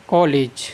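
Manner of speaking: कॉलेज as "kaw-lej"; English pronunciation. Shown as a demonstration. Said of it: "उच्चारण कैसे होता है".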